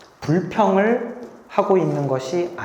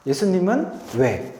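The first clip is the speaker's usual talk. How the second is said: normal talking voice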